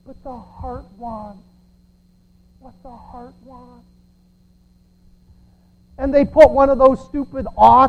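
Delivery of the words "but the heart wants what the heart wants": soft, slow, swooning movie line; hint of a sappy film heroine; mock movie sentiment. Mocking a movie character's sappy advice, soft slow swoon. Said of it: "and they're going".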